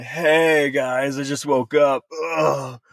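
droopy, defeated just-woke-up mumble; mock a washed-up uncle groggily; loser uncle voice. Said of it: "the voice of some loser uncle of yours being like".

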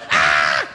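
loud "Ah!" as a mock scream; comic scream, loud and sudden; retold startled scream. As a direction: reenact your own scream of shock. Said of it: "I screamed like somebody was checking me".

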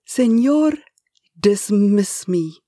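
put-on Spanish-accented, desperate plea, slow; pleading Spanish accent. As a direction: beg the boss in a Spanish accent. Eustasio begs to be fired.

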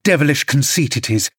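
plain reading voice

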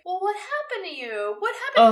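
put-on high-pitched kid's questioning; imitating curious little girl. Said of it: "the scene where Olive is like".

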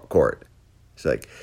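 ordinary talk, nothing marked

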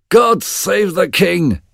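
high, slow hail to the king; a herald's high, slow greeting; calling out. Acted. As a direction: call it out across the hall. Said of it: "he called".

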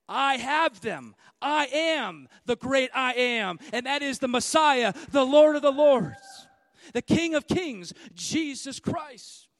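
loud, slow proclaiming; fiery sermon peak; preaching at full force. Acted. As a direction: thunder it out to the congregation. Preaching climax.

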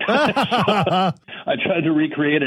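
slow, high words through laughter; laughing. Real, not acted. Retelling to the host how the hat would not roll again, laughing.

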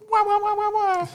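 high, loud wah wah horn blasts; air horn imitation. Celebrating Mo's shot with a mock air horn. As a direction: blare it like a hip-hop air horn. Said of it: "hip-hop air horn".

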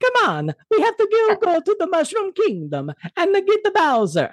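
high, cartoonish plumber voice; squeaky Italian plumber; video game Mario voice. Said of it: "an hour and a half of that voice".

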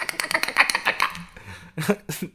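unvoiced giggle; breathy laugh. Real, not acted. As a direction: laugh silently. Laughing at own impression.

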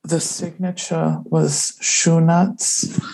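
Ross impression. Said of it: "I will do him now for you".

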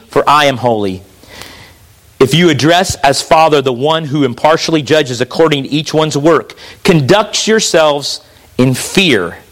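low, solemn Bible reading; scripture reading. Acted. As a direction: read the verse gravely, dropping at phrase ends. Reads scripture aloud.

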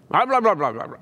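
high babbled grumbling noise; mock grumbling. Shown as a demonstration. Preacher demonstrating the Greek word for grumble, mock grumbling. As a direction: imitate grumblers with a run of nonsense muttering. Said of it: "They grumbled".

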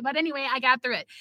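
normal talking voice

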